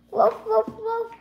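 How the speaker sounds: playful woof woof barks; dog barking. Pretend. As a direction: bark like a puppy.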